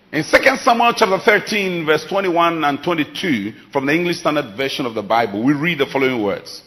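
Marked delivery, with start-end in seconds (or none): none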